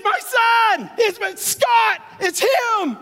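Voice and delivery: high ringing shout; calling out. Acted. Preacher shouting out to the congregation, high and loud. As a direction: cry it out to the crowd.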